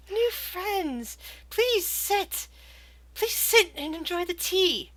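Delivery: put-on high, tearful little girl voice. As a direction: plead sweetly like a haunted child.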